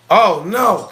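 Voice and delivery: high, loud exclaimed "Oh, no". Retold or imitated. Storyteller rejecting the foundation, loud exclamation. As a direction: blurt it out in alarm.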